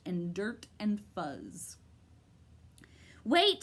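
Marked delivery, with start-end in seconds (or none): 3.2-3.6 louder call after him; shout. A narrator's reading. Milo shouting after the bird, louder. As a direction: call out as he flies off. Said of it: "shouted Milo".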